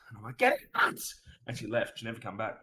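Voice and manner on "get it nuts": high, airy excited cry of triumph; hyped gamer yell. Retold or imitated. Gamer yells at the girl by the TV, hyped. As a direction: yelp it like you just scored the kill. Gamer celebrating hot streak.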